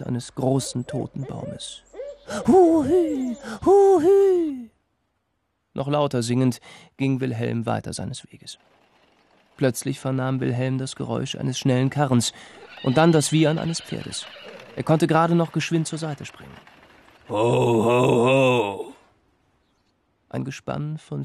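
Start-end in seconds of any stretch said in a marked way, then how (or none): 2.5-4.7 high, loud owl screech; shrill owl call, falling; owl hoot. Acted. Owl's screech imitated. 17.3-18.9 loud carter's call; booming ho ho ho; calling to horses. Acted. Driver halts the horses.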